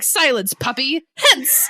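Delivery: Rochester snapping a haughty high dismissal; Rochester dismissing. Retold or imitated. Rochester waving off Lord Ingram, haughty loud dismissal. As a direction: brush the man off, lordly and loud. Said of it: "Rochester is like".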